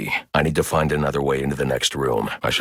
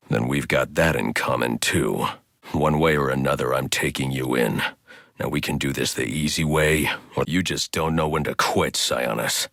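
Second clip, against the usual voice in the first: normal talking voice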